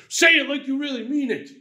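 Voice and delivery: pushy, high-pitched insistence; mock demand. Preacher playing a gunman pushing his hostage, mock demanding. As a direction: press them to sound sincere, with a lot of pitch.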